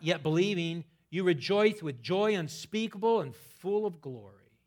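fiery preacher proclaiming the verse to the congregation; full-force preaching. Acted. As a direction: shout the scripture out to the whole church.

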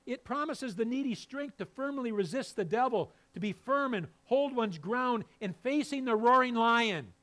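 loud, high, driving sermon voice; full-force preaching. Acted. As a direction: preach it out to the pews.

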